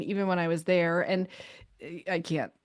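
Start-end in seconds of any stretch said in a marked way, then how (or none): none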